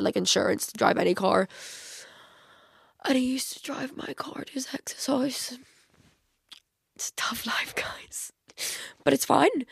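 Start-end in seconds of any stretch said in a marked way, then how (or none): 3.0-8.3 a soft confiding whisper. Real, not acted. Telling listeners about the ex, whispering. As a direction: lean in and whisper it. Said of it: "whispered aside".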